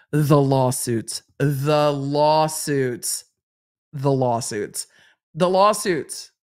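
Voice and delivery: slow, heavy, ominous repetition; drawn-out repetition. Real, not acted. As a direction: stretch each lawsuits out slowly. Repeating lawsuits dramatically.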